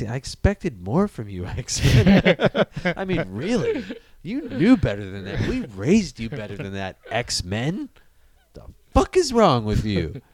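mock parental scolding, building from wounded to exasperated; half-laughing, hammed-up fatherly reproach; disappointed dad. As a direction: play a let-down dad telling off his kid. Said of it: "Like being the dad".

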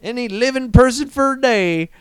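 put-on high drawn-out Southern accent. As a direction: ham up a Southern drawl on the question. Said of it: "it sounded like a Southern accent".

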